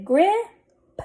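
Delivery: grip stretched on a rising tune, p delayed; blended slowly. Shown as a demonstration. Teacher sounding out a word for readers, blended slowly. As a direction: hold the i in grip, lifting it, then add p. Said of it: "Let's read this".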